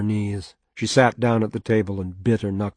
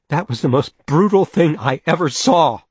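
higher and louder, appalled; outraged; 6 semitones higher, 6 dB louder than usual. Acted. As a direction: fling the accusation at him. Outraged accusation in quarrel.

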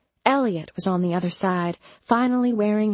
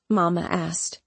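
ordinary delivery

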